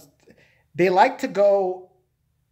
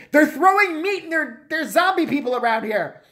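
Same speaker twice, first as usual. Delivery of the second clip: high-pitched, louder mock panic; mock alarm; 10 semitones higher, 4 dB louder than usual. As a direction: raise a hammed-up zombie alert.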